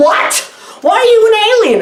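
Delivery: high, fast incredulous squeal; a squeaky why-are-you outburst; mock disbelief. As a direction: shriek the question in disbelief. Incredulous quoted reaction.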